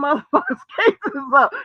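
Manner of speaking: ordinary delivery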